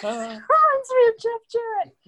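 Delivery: high, loud mock-excited outburst; excited quoted reaction. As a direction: blurt it like a thrilled fan. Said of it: "and I was like".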